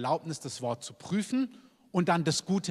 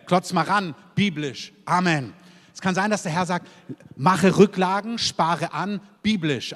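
loud, driving sermon delivery, punching each "biblisch"; full-force preaching; 2 semitones higher, 5 dB louder than usual. Acted. Preacher rallies the church, loud and driving. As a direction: preach it hard to the congregation.

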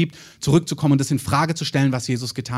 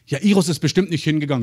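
ordinary talk

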